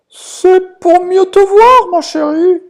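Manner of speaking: very high, slow, loud fake granny; drawn-out sugary fake grandma; wolf as grandma. (le loup déguisé, voix de mamie très aiguë et lente)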